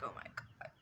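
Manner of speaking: breathless whisper; whispered despair. Retold or imitated. Reliving the moment she thought she lost her friend, whispered. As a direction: let it escape as a whisper of dread.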